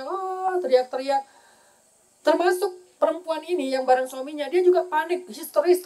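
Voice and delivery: ordinary talk, nothing marked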